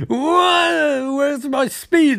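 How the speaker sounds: hammed-up panic at losing his powers; mock shocked cry. Panicked hero losing speed.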